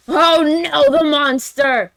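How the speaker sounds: loud, high, hammed-up alarm; mock horror. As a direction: cry out like a melodrama narrator. Dramatizing the monster's return.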